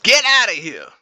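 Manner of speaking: high, loud playful shout; hammed-up shout at the rival; mock yell. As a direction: shoo him off with a big fake yell. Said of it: "Let me stop playing".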